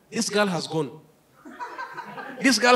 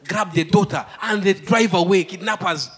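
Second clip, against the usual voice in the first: ordinary talk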